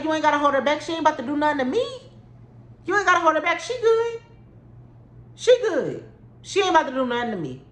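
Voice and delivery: mocking dismissal. Dismissing the dude.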